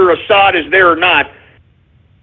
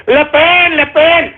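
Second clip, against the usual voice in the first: high, parroting mock chant; mocking supporters; 6 semitones higher, slower than usual. Mimicking all those idiots cheering, high mock chant. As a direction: ape the fans, pitched high. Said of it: "all those idiots".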